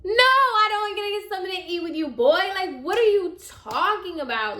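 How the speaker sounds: high, fast, incredulous brush-off; sassy scornful refusal. Retold or imitated. To CJ offering food after not defending her, scornful refusal. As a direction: shut him down, offended.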